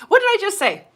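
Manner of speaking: fed-up loud repeat; exasperated. Real, not acted. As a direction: throw it out in disbelief at the troll. Repeats the line, then louder.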